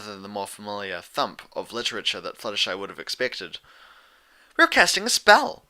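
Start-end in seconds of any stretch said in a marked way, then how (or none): 4.6-5.6 high, loud and bubbly; excited Pinkie. Acted. Pinkie telling Fluttershy about the spell, high and excited. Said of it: "Pinkie explained".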